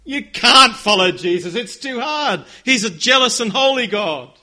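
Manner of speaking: passionate challenge. Acted. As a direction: throw down the challenge, loud. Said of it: "I wanna hear some passion".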